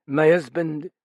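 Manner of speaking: words dragged from her; forced out. A narrator's reading. Widow forcing out the news of the suicide, wrenched. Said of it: "as though something were forcing the words".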